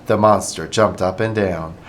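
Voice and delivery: ordinary delivery, nothing marked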